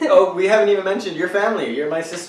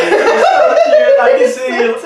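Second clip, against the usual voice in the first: squealed out high and slow through laughter; laughing tease; 9 dB louder, much slower than usual. Real, not acted. Host teasing guest over her cute voice, laughing high. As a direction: stretch it out, cracking up.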